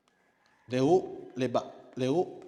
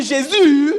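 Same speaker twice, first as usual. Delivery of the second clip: JÉSUS shouted high and long; loud church cry; 9 semitones higher, 16 dB louder than usual. Shown as a demonstration. Teacher showing intonation to Christians, high drawn-out shout. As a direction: cry out Jésus at full volume. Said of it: "vous voyez que c'est pas pareil".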